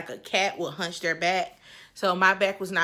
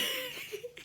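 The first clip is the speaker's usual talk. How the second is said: squeaky laugh; 15 dB quieter than usual. Real, not acted. Laughs while retelling throwing up.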